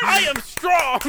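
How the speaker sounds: very high, loud indignant yell; hammed-up childish retort, shouted way up high; Noah. Snapping back at the weak-boy taunt, high defiant yell. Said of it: "And Noah goes".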